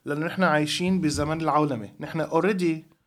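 normal talking voice

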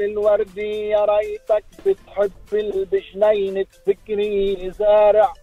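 zajal recitation. Acted. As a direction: declaim it forcefully. Rival poet's verse. (إلقاء زجل بقوة)

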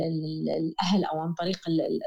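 ordinary talk, nothing marked

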